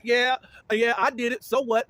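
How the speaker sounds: mock unapologetic comeback, pushed up high and firm; imitated defiance. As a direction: act out owning up defiantly. Acting out defiant admission of guilt.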